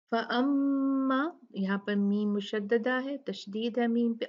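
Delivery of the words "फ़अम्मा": फ़अम्मा with a long nasal hum on the mm, slow; held ghunna. Shown as a demonstration. Teacher shows ghunna on the doubled meem. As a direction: stretch the मम्म in फ़अम्मा. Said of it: "अच्छे से गुन्ना करना है।".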